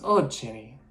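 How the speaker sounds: a self-mocking, clumsy Irish accent; hammed-up Irish brogue that swells on the repeat; bad Irish accent. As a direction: put on a rough Irish lilt for the quote. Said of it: "I'm gonna do a really bad Irish accent".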